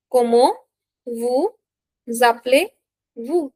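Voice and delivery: "Comment vous appelez-vous" in separate rising pieces; slow teaching breakdown of "Comment vous appelez-vous"; word by word. Shown as a demonstration. Teacher dictates phrase in pieces.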